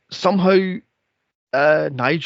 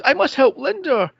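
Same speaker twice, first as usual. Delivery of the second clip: mock heroic Ethan; 9 semitones higher, 3 dB louder than usual. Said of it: "and he goes".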